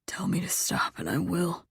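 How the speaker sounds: soft whispered male voice; hushed reassurance. A narrator's reading. Lover offering to stop, softly whispered. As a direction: whisper it as a tender promise.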